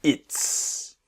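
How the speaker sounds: "its" with the s held long and hissing. Shown as a demonstration. Teacher modelling "its" for students with a long s. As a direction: stretch the final s of "its". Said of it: "that long S".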